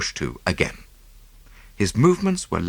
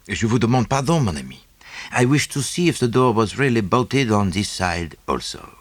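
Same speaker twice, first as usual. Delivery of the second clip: lightly voiced Belgian detective, precise and pleased; a hint of a fussy foreign sleuth; Poirot; 3 semitones higher than usual. A narrator's reading. Poirot explaining his test to Hastings, lightly voiced.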